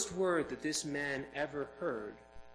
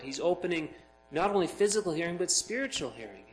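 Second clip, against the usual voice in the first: louder, higher, driving sermon; preaching at full force; 4 semitones higher, 6 dB louder than usual. Acted. Preacher rousing the congregation, loud and higher. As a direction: build the point like a pulpit climax.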